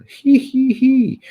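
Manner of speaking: high-pitched, drawn-out "hee hee hee"; high hee-hee giggle. Pretend. Streamer answering a fan who loves hillbillies, high giggle. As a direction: let out a squeaky, put-on giggle.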